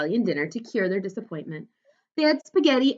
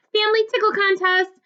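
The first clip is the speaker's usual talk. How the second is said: hollering; 12 semitones higher, 3 dB louder than usual. A narrator's reading. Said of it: "He laughed and hollered".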